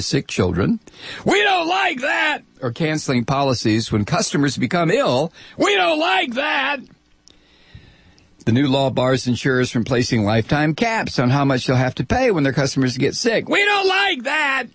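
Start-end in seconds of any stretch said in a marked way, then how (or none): 1.2-2.4 high slow shouted witch. Acted. Insurers voiced, shouting. 5.6-6.8 high slow shouted witch. Acted. Insurers voiced, shouting. 13.5-14.6 high slow shouted witch. Acted. Insurers voiced again.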